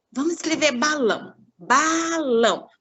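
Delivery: put-on teacher prompting child. Shown as a demonstration. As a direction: coax the child, drawing the word out. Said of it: "teacher models dictating to child". (professora ditando devagar)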